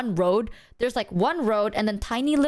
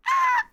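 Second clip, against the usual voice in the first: high shriek. Real, not acted. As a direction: let out a piercing squeal. Excited shriek ending story.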